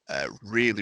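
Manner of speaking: normal talking voice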